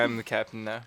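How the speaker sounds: put-on lightly done African accent. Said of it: "In the African accent?".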